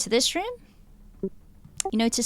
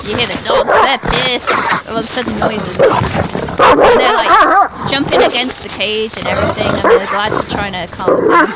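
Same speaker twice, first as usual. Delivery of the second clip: much louder, urgent scene telling; loud excited narration; 13 dB louder than usual. A narrator's reading. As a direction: call over the racket of barking dogs. GM narrates sudden dog commotion.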